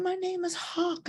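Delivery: put-on high, soft, slow child voice; little boy. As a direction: imitate a shy little boy answering. Imitates the boy.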